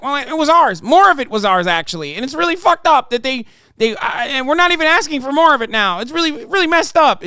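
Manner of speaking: high, loud whining mock of the guest; mock him like a whining baby, pitched high; whiny mocking impression. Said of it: "you can't just be like".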